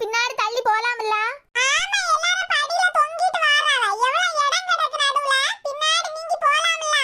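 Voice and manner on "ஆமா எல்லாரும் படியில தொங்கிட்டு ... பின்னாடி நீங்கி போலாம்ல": higher-pitched complaining bus passenger. Acted. Passenger urging people off the crowded steps to move in, higher-pitched complaint.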